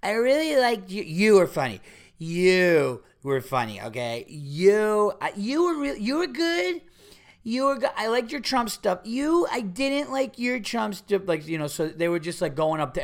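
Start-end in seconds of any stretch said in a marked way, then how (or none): 0.0-4.2 put-on loud, high, drawn-out drunk gushing; drunk woman. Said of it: "The women just walk up and go". 4.3-11.2 put-on high-pitched drunken gushing; drunk woman. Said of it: "The women just walk up and go".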